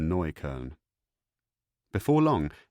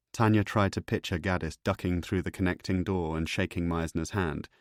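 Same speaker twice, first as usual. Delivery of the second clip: ordinary delivery, nothing marked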